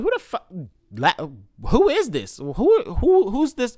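puzzled, squeaky who-is-this; high baffled disbelief. Retold or imitated. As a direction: pitch jumps way up in confusion. Confused reaction to the last-contact message.

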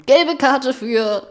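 football commentator. Pretend. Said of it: "Was Kommentator halt so machen".

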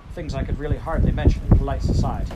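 the lady of the house speaks her mind. Acted. Mrs Seisman voiced loudly.

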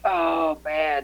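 high relieved oh man; relieved exclamation. Retold or imitated. Reaction to flush.

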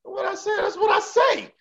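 high-pitched squealed retort; squeaky, mock-indignant defense; defensive protest. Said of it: "I was like".

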